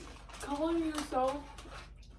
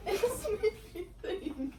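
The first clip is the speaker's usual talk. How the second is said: laughing through the words; giggling, higher; 9 semitones higher than usual. Real, not acted. Same line, laughing then plain.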